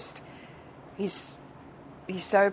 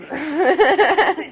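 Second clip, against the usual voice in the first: bright burst of laughter; loud laugh; 11 semitones higher, 11 dB louder than usual. Real, not acted. Laughs at Brits remark.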